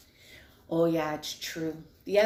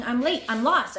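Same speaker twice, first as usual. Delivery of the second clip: louder first cry of late and lost; yelling; 5 semitones higher, 7 dB louder than usual. A narrator's reading. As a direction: call it out in a panic. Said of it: "he was yelling".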